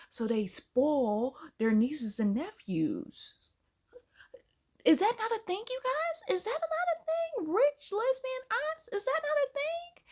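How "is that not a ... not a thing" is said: much higher, asking in disbelief; incredulous high plea. Real, not acted. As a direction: pitch it way up, begging the viewers. Pleading with viewers.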